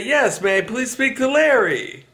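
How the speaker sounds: polite caller voice. Retold or imitated. Call center agent asking for Larry, politely. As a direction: sound sweetly professional asking for Larry. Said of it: "And you say".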